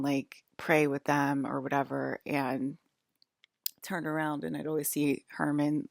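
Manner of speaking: ordinary talk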